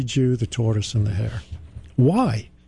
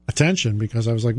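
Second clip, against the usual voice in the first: usual speaking voice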